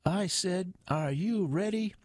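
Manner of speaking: high-pitched MC crowd call; screeched. A narrator's reading. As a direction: push the crowd for a bigger answer, pitched high. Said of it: "the M C screeched".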